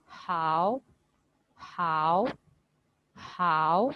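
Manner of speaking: "हाउ" held long and low with a lift at the end; first tone demo. Shown as a demonstration. Teacher shows how first tone would read, drawn out. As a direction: stretch हाउ out slowly from a low pitch. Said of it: "अगर हाउ पे first tone होती".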